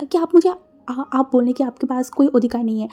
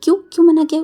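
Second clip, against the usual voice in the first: stuttering, then a sudden louder question; shocked stammer; 3 semitones higher, 5 dB louder than usual. Acted. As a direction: caught off guard, stumbling into a louder why. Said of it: "थोड़ा shock में बोला". (हकलाते हुए चौंककर पूछना)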